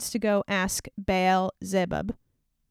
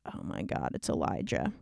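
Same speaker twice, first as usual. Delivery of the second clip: much lower and softer, breathless whisper; horrified whisper; 8 dB quieter than usual. Acted. Azahiah realizes the stranger is Elijah, in a horrified whisper. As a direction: dread dawning, hushed.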